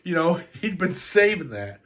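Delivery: cracking up while trying to stop; laughing. Real, not acted. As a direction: talk through the laughs, apologetically. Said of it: "I shouldn't be laughing".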